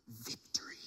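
whispered, hushed triumph; triumphant whisper. Retold or imitated. Flight attendant holding up the phone, whispered triumph. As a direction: breathe it out like a secret win.

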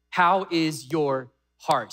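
HOW IS YOUR HEART drawn out slowly, word by word; slow and weighty on "How is your heart"; slow, pointed repeat. Real, not acted. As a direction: ask "How is your heart" at half pace. Preacher repeats question for emphasis.

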